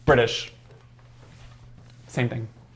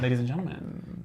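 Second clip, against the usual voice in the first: announcer; 4 semitones lower, 15 dB quieter than usual. Pretend.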